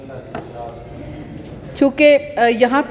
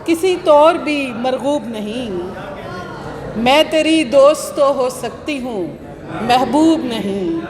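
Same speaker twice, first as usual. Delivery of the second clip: slow, measured mushaira delivery; Urdu poetry recital; slower than usual. Acted. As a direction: declaim each half-line, then let it hang. Recites her couplet at mushaira.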